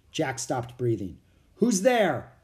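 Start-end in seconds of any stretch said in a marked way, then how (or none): 1.6-2.3 loud, high challenge; shouted. A narrator's reading. Captain challenging someone hidden behind a rock, shouted. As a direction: call out sharply into the dark. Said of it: "the captain shouted".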